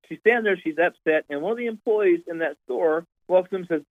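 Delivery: ordinary delivery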